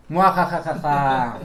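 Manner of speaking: evil laugh, slow and loud; sneering panto villain. Acted. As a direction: cackle like a cartoon villain. Risa malvada de Halloween. (risa malvada lenta de villano)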